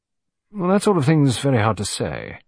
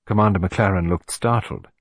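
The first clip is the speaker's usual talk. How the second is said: ordinary delivery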